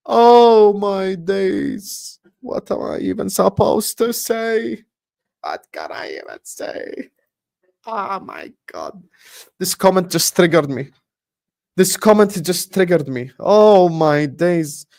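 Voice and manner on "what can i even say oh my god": breathless hushed whisper of disbelief; defeated whisper. Real, not acted. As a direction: barely breathe the words out, beaten. Stunned speechless after ranting.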